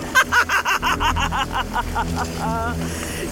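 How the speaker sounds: high mad cackle trailing off; crazed, triumphant cackling; maniacal laughter. Acted. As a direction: laugh wildly until it winds down.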